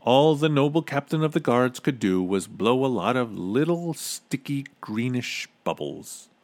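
ordinary delivery, nothing marked

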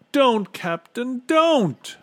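very high, loud, drawn-out cry; desperate high-pitched begging; frantic plea. A narrator's reading. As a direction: cry out to stop him. Bartholomew begs captain to stop.

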